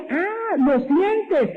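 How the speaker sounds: drawn-out, high 'ah' of catching someone out; mock realization. Imagined debate with a skeptic. (falsa sorpresa triunfal, alargada)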